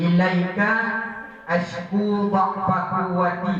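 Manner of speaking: slow Arabic plea, drawn out; slow, pleading Arabic prayer; prayerful recitation. Acted. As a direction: chant the supplication slowly. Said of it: "kata Rasulullah".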